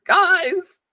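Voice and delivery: high panicked yelp; panicked high call. Acted.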